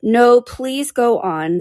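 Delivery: slow, high, pleading; drawn-out, meek entreaty; humble. Acted. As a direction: beg humbly, stretching each word. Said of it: "said Alice very humbly".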